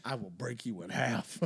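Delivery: barbarian. Pretend. In-character heel promo reply.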